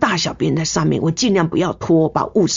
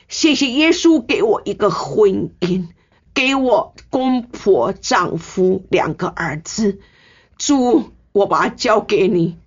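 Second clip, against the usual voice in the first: slow, higher prayer of thanks; a nurse's farewell prayer to God before the ward, slow and raised; heartfelt prayer; 3 semitones higher, slower than usual. Retold or imitated. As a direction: pray as if saying goodbye, slowly. Her prayer before leaving.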